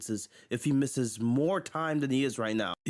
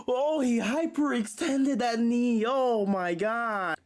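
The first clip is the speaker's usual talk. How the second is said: gasp, then a slow, stunned oh my God; shocked gasp; 8 semitones higher, slower than usual. Real, not acted. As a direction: react in shock at an injury. Watching Embiid injure his knee.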